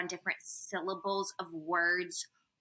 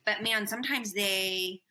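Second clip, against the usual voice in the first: ordinary talk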